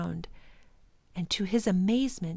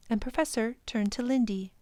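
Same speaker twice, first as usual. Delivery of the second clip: ordinary delivery, nothing marked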